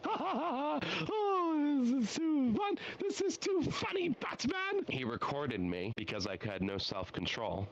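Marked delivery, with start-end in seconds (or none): 0.0-4.8 high, wild cackling Joker voice with laughter through it; a manic clown villain giggling at Batman; Joker impression. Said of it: "I'm gonna put a Joker impression".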